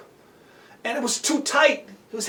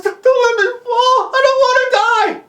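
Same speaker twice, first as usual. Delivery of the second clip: high, loud, desperate wail; terrified pleading; 12 semitones higher, 5 dB louder than usual. Acted. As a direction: plead for your life, pitched way up. Terrified boy begs his rescuer.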